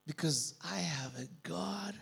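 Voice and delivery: unvoiced hushed whisper. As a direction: confide it in a whisper. Believer whispering faith.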